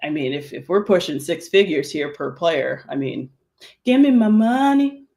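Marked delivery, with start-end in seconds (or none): 3.8-5.0 demanding. Pretend. Demanding pay, playful.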